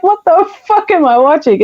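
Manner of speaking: high, baffled outburst; mock bewildered cry; disbelief. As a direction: throw up your hands at the screen. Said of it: "It's like".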